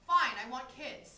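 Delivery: loud, slow, grudging confession; exasperated admission. Acted. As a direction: give in and blurt it.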